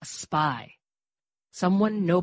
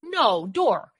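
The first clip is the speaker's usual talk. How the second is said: louder, higher no; firm refusal; 5 semitones higher, 8 dB louder than usual. A narrator's reading. Mary refuses Dor's scheme, firm and loud. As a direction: put your foot down.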